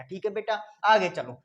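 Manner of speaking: ordinary delivery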